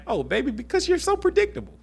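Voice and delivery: high, sugary mock-sweet husband voice; condescending husband. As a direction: talk down to her sweetly. Husband mocking wife as predictable.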